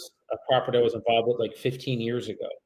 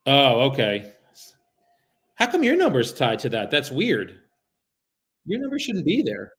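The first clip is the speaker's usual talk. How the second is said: bright, higher courteous caller voice; polite phone sales voice; 4 semitones higher than usual. Acted. Caller greeting a stranger on the phone, polite and raised. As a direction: sound friendly and eager to the seller.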